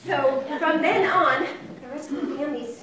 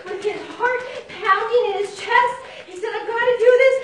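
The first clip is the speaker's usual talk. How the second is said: high, loud, breathless narration; excited storytelling; 8 semitones higher, 6 dB louder than usual. A narrator's reading. Storyteller to young listeners as the mouse gathers courage, high and excited. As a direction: build the tension, pitched high and loud.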